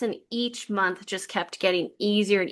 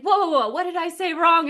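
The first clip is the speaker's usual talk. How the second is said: higher, alarmed retelling of a startled doctor; 7 semitones higher than usual. Retold or imitated. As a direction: backpedal fast, caught off guard by tears. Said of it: "He's like".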